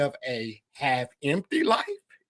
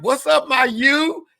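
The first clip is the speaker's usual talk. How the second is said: imitating friend; 10 semitones higher, 7 dB louder than usual. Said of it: "he'd be like".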